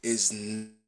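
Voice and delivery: breaking up on a call; bad connection. Real, not acted. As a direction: voice cutting out over a bad line. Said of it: "We can't hear you anymore".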